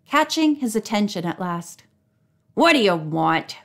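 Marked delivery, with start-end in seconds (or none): 2.5-3.6 low, grumpy man's voice; grumbling. A narrator's reading. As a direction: grumble it, annoyed. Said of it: "he grumbled".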